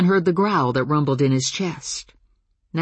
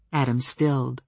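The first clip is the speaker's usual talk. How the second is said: ordinary delivery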